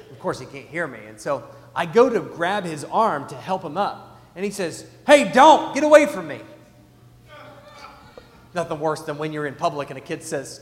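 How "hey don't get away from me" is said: put-on loud high kid's protest; mock yell. Imitating his son yelling at dad in the surf. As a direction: yell it like a stubborn kid.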